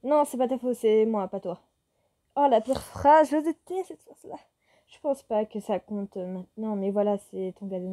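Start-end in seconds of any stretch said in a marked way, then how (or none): 2.4-4.4 louder, disgusted outburst; annoyed reader groaning at the cliché breakup line; exasperated groan. Real, not acted. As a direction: moan at the cliché.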